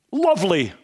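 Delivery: bright, clipped-free British "Lovely," lightly mocked British accent. Navy SEAL greets the trapped Thai boys, mock British accent. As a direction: say it like a cheery British diver. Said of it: "'cause that's the way British talk".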